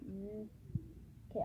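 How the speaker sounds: ordinary delivery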